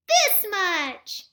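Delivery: high, loud little hare; eager small child answering; little bunny showing off with arms wide. Acted. Little hare answers his father.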